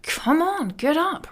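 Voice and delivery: high-pitched, exasperated urging; a bit annoyed. Acted. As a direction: nag someone out of bed. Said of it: "kind of a bit annoyed".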